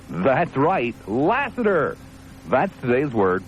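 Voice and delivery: high, swooping reveal of the word; grand high-pitched unveiling; dramatic announcement. Real, not acted. Big reveal of today's word.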